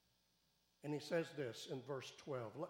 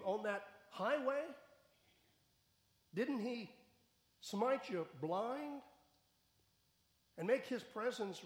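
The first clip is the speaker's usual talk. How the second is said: higher, louder challenge to Paul; preaching at full force; 7 semitones higher, 5 dB louder than usual. Acted. Preacher confronting Paul on the Damascus road, in a raised challenging voice. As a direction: press Paul hard with each question.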